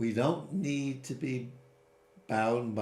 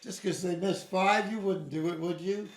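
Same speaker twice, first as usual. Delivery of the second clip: higher, earnest bargaining plea; pleading Abraham; 8 semitones higher, 3 dB louder than usual. Retold or imitated. Abraham pleading with God to spare the innocent, higher and earnest. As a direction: coax him like a lawyer pressing a case. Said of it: "Abraham says".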